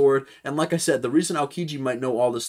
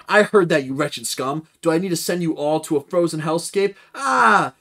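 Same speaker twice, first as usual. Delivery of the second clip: louder, higher threatening snarl; angry threat; 5 semitones higher, 6 dB louder than usual. Acted. Kuzan threatening the crew, louder angry threat. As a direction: menace the scum who insulted you. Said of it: "And then he says back".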